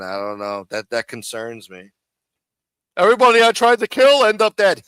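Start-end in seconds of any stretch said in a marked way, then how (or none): 3.0-4.8 mock tough-guy quote. Mimicking the guest's clip line.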